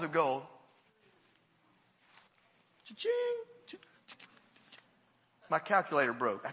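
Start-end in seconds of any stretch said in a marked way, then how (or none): 2.9-3.8 high squeaky cha-ching like a till; mock cash register sound. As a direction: sing out the till's ding.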